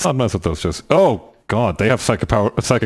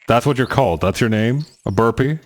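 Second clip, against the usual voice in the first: ordinary talk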